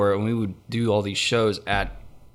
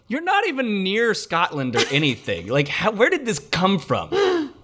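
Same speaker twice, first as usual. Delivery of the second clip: higher-pitched, baffled challenge; mock incredulity; 7 semitones higher than usual. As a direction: protest in playful disbelief. Mock-challenges the Cockney man.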